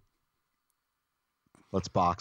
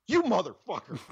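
outraged disbelief; 13 semitones higher, 4 dB louder than usual. Retold or imitated. Realizing the prank.

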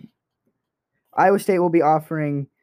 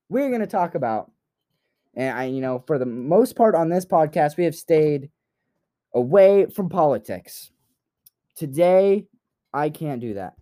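ordinary delivery

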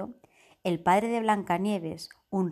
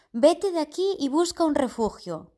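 urgent hunter; 7 semitones higher, 3 dB louder than usual. Acted. Hunter urges her to flee.

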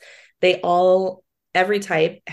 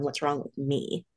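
soft, slowed worried murmur; asking herself quietly what's wrong; quiet self-doubt; 4 semitones lower, 8 dB quieter, slower than usual. Retold or imitated. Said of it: "rather than going".

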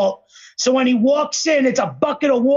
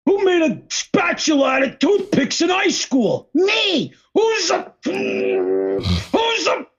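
high, shouted cartoon chant; SpongeBob voice; 8 semitones higher, slower than usual. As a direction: belt it like an eager cartoon sponge. Quoting SpongeBob's song chant.

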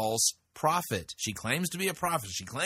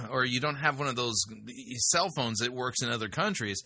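ordinary talk

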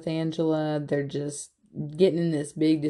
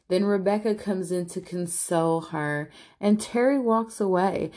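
ordinary delivery

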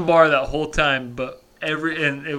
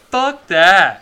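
loud, high mock outrage; indignant refusal; 6 semitones higher, 8 dB louder than usual. Teller replays refusing the seventy-dollar drinks, indignant.

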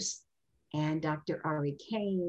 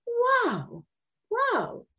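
much higher, louder, in awe; amazed wow; 5 dB louder than usual. Retold or imitated. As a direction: gush with wide-eyed amazement. Awed reaction at the parade.

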